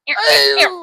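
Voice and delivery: high, loud squeal of disgust. Retold or imitated. Reader reacting to a gross threat, loudly disgusted.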